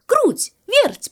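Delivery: high, bright call to two friends. A narrator's reading. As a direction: call them over loudly and high. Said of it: "позвал петушок". (звонко зовёт)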